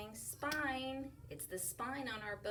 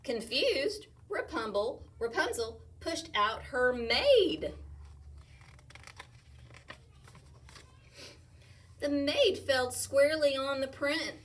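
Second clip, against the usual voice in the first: lively read-aloud, louder as it builds; reading to children; 4 semitones higher, 7 dB louder, slower than usual. Acted. Teacher reading a rhyming picture book aloud. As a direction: bounce through the rhymes for kids.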